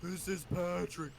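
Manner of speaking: slow, dopey cartoon voice; slow cartoon sidekick impression; Patrick. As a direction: drag the words out in a goofy impression. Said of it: "doing Patrick".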